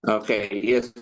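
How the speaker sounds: choppy, garbled bad connection; words cutting out over a bad line; radio breaking up. Real, not acted. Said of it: "You're breaking".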